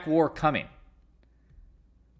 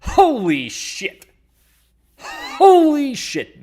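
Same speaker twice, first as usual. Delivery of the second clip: slow, loud, much higher drawn-out exclaim; slightly wrong copied voice; 13 semitones higher, 11 dB louder, much slower than usual. Retold or imitated. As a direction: stretch it out loud and high, missing the voice. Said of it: "trying to mimic some voice. I didn't get it right".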